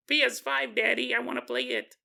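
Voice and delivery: put-on high-pitched little kid voice; kid pleading with daddy. Kid asking Daddy to play video games, high childlike pleading. Father voices his kid's request.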